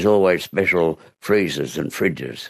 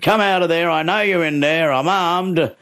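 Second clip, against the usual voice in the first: high-pitched challenge; homeowner calling out; 11 semitones higher than usual. Retold or imitated. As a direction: warn the burglar from outside the cupboard. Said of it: "he grabbed himself a weapon and he said".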